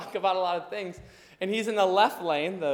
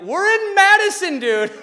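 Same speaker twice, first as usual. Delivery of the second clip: much higher, louder, stretched out; incredulous shout; 14 semitones higher, 15 dB louder than usual. Retold or imitated. Telling his friend they've reached Madison, incredulous shout. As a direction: yell it in comic disbelief. Shocked at how far they drove.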